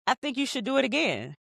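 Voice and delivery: high, lilting retold suggestion; tentative suggestion. Issa critiques the take in the studio, tentative. Acted exchange in studio scene.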